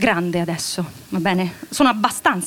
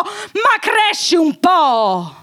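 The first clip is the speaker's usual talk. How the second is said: angry shout; 8 semitones higher, much slower than usual. Acted. Wife shouts at her husband to grow up. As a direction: hurl it at him.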